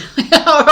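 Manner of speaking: loud bright laugh bursting out; burst of laughter. Real, not acted. Laughing at her own joke to viewers, loud real laugh.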